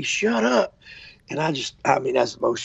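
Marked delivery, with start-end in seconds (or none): 0.0-0.8 retold scream. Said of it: "this woman is screaming at me".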